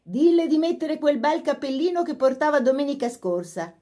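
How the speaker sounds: mother's voice. A narrator's reading. Mother calling from stairs.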